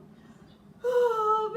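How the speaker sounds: loud high moaning sigh; hammed-up groaning sigh; big sigh. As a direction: heave a dramatic sigh. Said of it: "Bear sighs and he moans".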